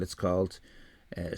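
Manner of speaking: usual speaking voice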